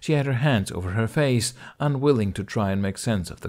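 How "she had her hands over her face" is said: plain reading voice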